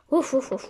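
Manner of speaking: playful woof woof barks; dog barking. Pretend. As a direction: bark like a little dog.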